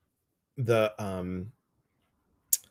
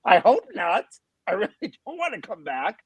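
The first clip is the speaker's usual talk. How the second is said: laughing joke; 10 semitones higher than usual. Retold or imitated. Retold joking reply to the nurse.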